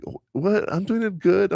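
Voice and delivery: high, baffled "what? I'm doing it good"; indignant mom. Retold or imitated. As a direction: protest high, offended. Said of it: "your mom was so like".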